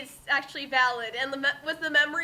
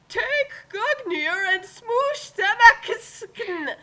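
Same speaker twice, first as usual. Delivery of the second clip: slow, high, big theatrical proclamation; hammed-up hero line; 7 semitones higher, 6 dB louder, much slower than usual. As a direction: declaim it like a cheesy epic hero. Reading a hammy fanfic line.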